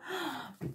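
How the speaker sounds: quick surprised intake of breath; startled gasp; sharp gasp. Real, not acted. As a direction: gasp at the card. New card surprises her.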